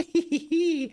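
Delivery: high, piercing villain cackle; evil cackle. Acted. Villain cackling after vowing revenge on his foe. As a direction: cackle like a gloating fiend.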